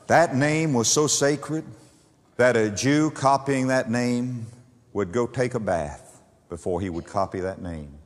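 normal talking voice

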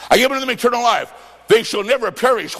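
rattled off fast; quick recitation. Shown as a demonstration. Preacher repeating the verse quickly to the man. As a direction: rush through the verse as a reminder. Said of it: "rapid repeat of verse".

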